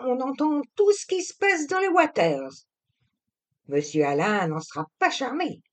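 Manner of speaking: high, quick gossiping servant voice; gossiping maid. A narrator's reading. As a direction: chatter it like a nosy old maid. Voices Basque maid Juliette gossiping. (servante commère, voix aiguë et rapide)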